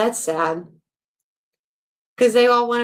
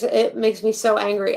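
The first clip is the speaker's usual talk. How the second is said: ordinary talk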